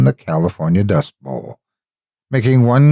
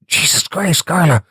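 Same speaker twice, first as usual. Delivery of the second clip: high startled warning to the driver; sudden fright, voice jumping up; alarmed cry; 9 semitones higher than usual. Acted. Jennifer warning Carla of the creature ahead, high alarmed cry.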